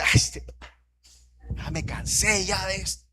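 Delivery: exasperated parent. Acted. Father fed up with correcting his son, exasperated. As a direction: act out a father at the end of his patience.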